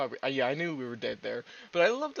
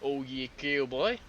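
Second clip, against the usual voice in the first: slow, drawn-out mock American; slower than usual. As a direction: stretch it like a cartoon American. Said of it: "not the stereotypical".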